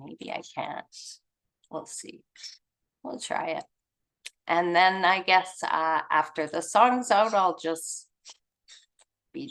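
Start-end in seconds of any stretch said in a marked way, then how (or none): 0.0-3.6 whispered aside to herself; under breath. Real, not acted. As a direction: drop to a whisper, thinking aloud. Aside while trying to share screen.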